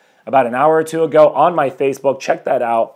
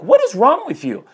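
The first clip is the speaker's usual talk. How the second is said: incredulous friend; 9 semitones higher than usual. Retold or imitated. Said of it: "And he's like".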